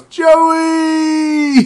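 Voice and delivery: high, loud call of Joey!; Hank's shout. Retold or imitated. Host imitating co-host Hank's loud call of Joey. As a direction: yell Joey! like Hank. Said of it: "I like when Hank goes".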